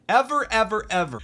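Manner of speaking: ordinary delivery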